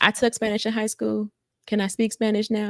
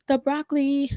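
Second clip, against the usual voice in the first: high, hesitant waitress voice; imitated waitress; 9 semitones higher than usual. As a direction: put on a higher voice reading back an order. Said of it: "she was like".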